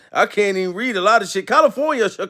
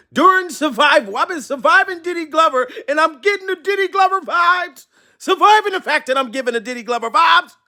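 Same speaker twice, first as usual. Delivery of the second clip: high-pitched, excited riff; hyped-up comic ranting; mock excitement; 7 semitones higher than usual. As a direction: play it up, raised pitch and worked up.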